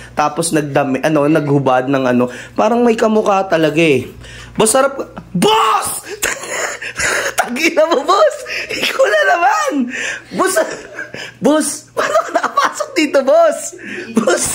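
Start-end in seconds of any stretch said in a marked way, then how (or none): 4.5-10.5 high-pitched shout breaking into laughter; outraged laughing shout at the intruder; shocked laughing yell. Acted. Finds the intruder back again. 11.4-14.4 laughing outrage. Acted. Confronting the intruder.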